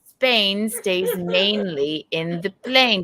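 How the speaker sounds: mock English elocution; put-on English accent. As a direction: recite it in a theatrical English accent. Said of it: "You kinda sound like [name]".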